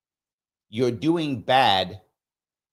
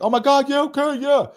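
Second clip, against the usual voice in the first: much higher and louder, imitating overjoyed; excited acceptance; 6 dB louder than usual. Young Marine invited for Christmas, overjoyed. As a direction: gush like the best invite ever. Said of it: "bubbling with happiness".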